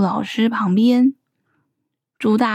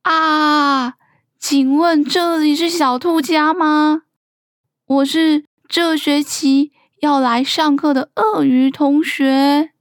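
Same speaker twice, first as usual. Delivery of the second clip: higher-pitched newcomer calling out, only mildly loud; mildly big voice, pitched up and stretched at the end; 5 semitones higher than usual. A narrator's reading. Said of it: "突然有个很大的声音问".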